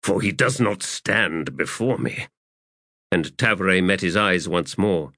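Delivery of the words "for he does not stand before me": ordinary delivery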